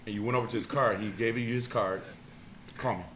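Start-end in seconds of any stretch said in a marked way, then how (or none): 2.8-3.0 put-on old-timey voice. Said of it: "old-timey voice".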